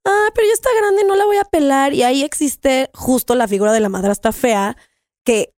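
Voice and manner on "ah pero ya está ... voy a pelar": much higher, mock snooty brush-off; lightly voiced careless woman shrugging it off; dismissive stepmother. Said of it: "existen chavas que dicen".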